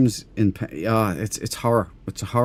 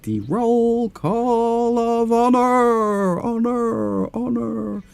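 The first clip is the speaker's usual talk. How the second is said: slow, high proclamation fading out in echo; mock announcer echo; 11 semitones higher, much slower than usual. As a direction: boom it out then let the echo die away. Announcing the honor roll.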